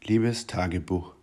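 slow, soft and weary; gloomy diary opening. Shown as a demonstration. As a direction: sigh it out like a sulking diarist. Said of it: "diary entry read aloud". (langsam, leise und niedergeschlagen)

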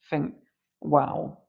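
usual speaking voice

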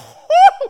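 a big real laugh bursting out; real loud laugh; loud burst of laughter. Real, not acted. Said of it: "And I'm like".

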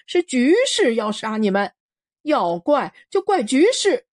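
拔高音调大声发号施令; 挥枪高声宣判的恶霸; 丁八子喊. Acted. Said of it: "丁八子挥着驳壳枪喊". (恶霸司令高声喊话)

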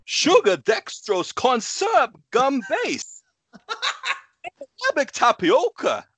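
high, loud halting reading of a label; jerky, very high mock Walken read; Christopher Walken impression. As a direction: read the gum label with odd Walken pauses. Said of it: "let's all do our Christopher Walken impression".

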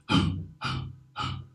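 quick sharp unvoiced gasps; short breaths. Shown as a demonstration. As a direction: pant in short breaths without voice. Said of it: "try to go short breaths with no vocals".

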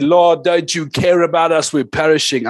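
loud, pleading cry of a desperate church; crying out to God. Retold or imitated. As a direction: call out to God, begging to be heard. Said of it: "We cried out and we said".

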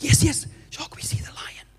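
put-on children's hushed whisper; imitating kids whispering. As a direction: whisper along like excited kids on safari. Kids play along in whispers.

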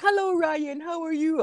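squeaky-high, chirpy mock greeting; cheerful coworker. Morning person greets grumpy workmate, mock cheerful. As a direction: put on an over-cheerful falsetto. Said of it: "they're so cheerful too".

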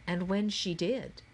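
ordinary delivery, nothing marked

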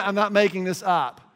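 ordinary talk, nothing marked

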